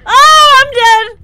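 high, loud cry of dismay; alarmed yell. Real, not acted. Player dying in the game, loud high wail. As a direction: cry out as the game kills you.

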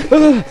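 piercing high scream of fright; real, very loud shriek; terrified scream. Real, not acted. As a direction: scream at a sudden jump scare. Jump scare in the game.

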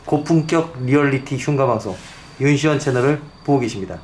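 loud, punchy channel intro; big opening ident; show announcer. Acted.

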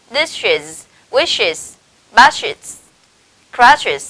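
"dishes, wishes, baskets, crutches" as separate careful model words; IS endings after SH. Shown as a demonstration. Teacher modelling sh-words for students, "dishes, wishes, baskets, crutches" said carefully. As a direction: list "dishes, wishes, baskets, crutches", sounding each final syllable. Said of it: "chúng ta sẽ đọc là".

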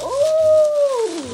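long, very high, loud "ooh"; high sustained coo of delight; excited squeal. Real, not acted. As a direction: squeal over the new pajamas. Excited unboxing of new pajamas.

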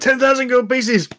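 high shouted blurt; sudden shout. Acted. Brother suddenly shouts his demand aloud, high. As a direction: shout the sum out loud. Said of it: "you can hear him suddenly shout out loud".

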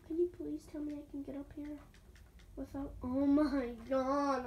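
usual speaking voice

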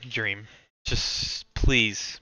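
usual speaking voice

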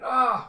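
high, loud retold scream. Someone screaming as the vampire attacks, loud high scream. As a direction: let out a sharp mock scream.

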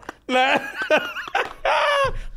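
high-pitched helpless laugh; laughter. Real, not acted. Laughing at his own chef story, high and helpless.

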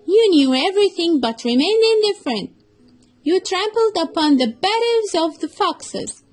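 a fox finally judging the cruel elephant; accusing fox. Acted. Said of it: "said the fox".

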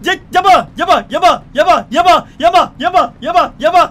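loud, high, breathless yelling; frantic chase shouting. Pretend. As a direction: scream after a runner who won't stop. Cop chasing fleeing suspect. (도망자를 쫓으며 숨차게 외치는 고함)

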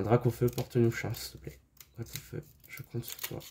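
a whispered prayer to the card for luck; hushed plea. Real, not acted. Vlogger pleading with Dracofeu before the last booster, fading to a whisper.

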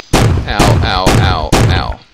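loud playful yelps of pain; mock pain. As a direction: yelp as if you got hit. Game character hurt.

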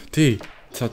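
normal talking voice

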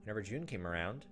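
ordinary talk, nothing marked

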